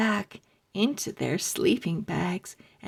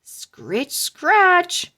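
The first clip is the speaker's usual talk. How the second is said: high, loud spooky taunt; spooky call; 9 semitones higher, 6 dB louder than usual. Acted. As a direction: tease them with a ghostly call. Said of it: "she called after them".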